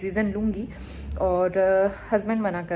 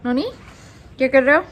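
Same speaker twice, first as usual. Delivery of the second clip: calling out; 6 semitones higher, 10 dB louder, faster than usual. Real, not acted. Mother calling up to her son playing in the sun, loud and high. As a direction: call up the stairs to him.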